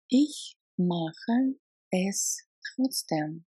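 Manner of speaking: "Ich mache es trotzdem" at half speed; slow repeat. Shown as a demonstration. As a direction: drag it out so learners hear every sound.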